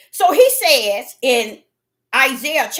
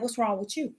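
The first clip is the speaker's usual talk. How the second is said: very soft, low rebuke; voice of God; 4 semitones lower, 16 dB quieter than usual. Retold or imitated. God questioning the upset preacher, hushed and low. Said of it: "He said".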